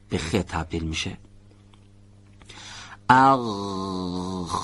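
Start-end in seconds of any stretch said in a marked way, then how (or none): 3.1-4.5 اغ begins as a voiced gargle and then fades into a voiceless خ; اغ sliding from voiced غ to voiceless خ. Shown as a demonstration. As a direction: start اغ with the vocal folds buzzing, then drop the voice.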